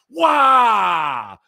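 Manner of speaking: very high, loud, long falling "Wah!"; gleeful shouted wow. Shown as a demonstration. Host showing listeners his favorite new word, gleeful drawn-out shout. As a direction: let out a huge stretched shout of amazement. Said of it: "my favorite thing to say now".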